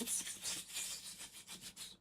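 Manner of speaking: soft hissing whispered psst; drawn-out s like a snake. Retold or imitated. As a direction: hiss it out like a snake. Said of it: "chat said psst".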